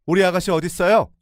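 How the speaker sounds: shouting to find her sister-in-law; loud demand. Retold or imitated. Sister-in-law's mother-in-law pushed aside, shouting. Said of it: "큰 소리로 물었어요".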